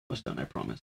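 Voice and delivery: hushed, almost breathless promise; near whisper. Real, not acted. Talking to a child off-mic.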